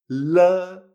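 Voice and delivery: "la" with an l as in Spanish, vowel held; Chinese L sound. Shown as a demonstration. Teacher demonstrates L E, "la" with a Spanish-style l. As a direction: draw out "la" after a Spanish l. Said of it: "exactamente igual que la L".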